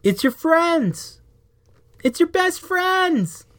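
high, slow, drawn-out call; stretched-out high hail to a friend. Acted. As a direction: sing it up to a giant who can barely hear. Calling up to giant friend.

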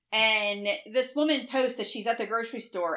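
ordinary talk, nothing marked

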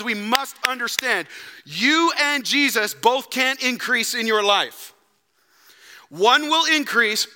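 loud, high, driving sermon voice; preaching at full force. Acted. Pastor urging Harvest Ottawa to decide, full-force preaching. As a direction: press the call home to the church.

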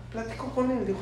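ordinary delivery

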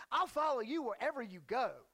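eager pledge with emphatic punch; excited follower. Acted. Would-be follower pledging himself to Jesus, excited. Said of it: "He's excited. He's amped".

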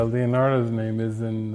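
normal talking voice

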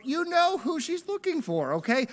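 very high, incredulous scolding; mock exasperation. Preacher chiding Jesus over Mary's search, exasperated. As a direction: squeal it in comic disbelief at the feigned ignorance.

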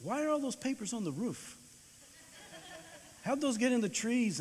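puzzled dad looking at the roof; father spotting papers on the roof and trees; dad surprised. Retold or imitated. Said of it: "and he said".

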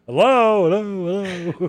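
high, loud hello fading away; mock hello echoing in an empty room. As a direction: call into an empty head. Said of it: "you're like".

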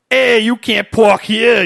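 loud, drawn-out Bronx tough-guy impression; Bronx accent. Podcaster mocking a movie tough's botched Chicago accent, exaggerated Bronx accent. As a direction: belt it out in a hammed-up Bronx accent. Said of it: "A guy from, like, the Bronx or something".

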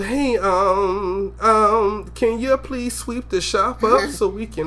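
high, slow, hesitant meekness; mock timid, shrunken request; shriveled voice. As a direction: ask as if tiptoeing round them. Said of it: "that little shrivel in your voice".

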